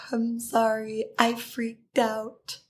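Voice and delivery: softly whispered, slow sheepish sorry; whispered apology. Retold or imitated. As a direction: whisper it like a guilty kid. Apologizing to the friend.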